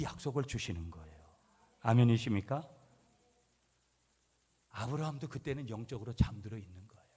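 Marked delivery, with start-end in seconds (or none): none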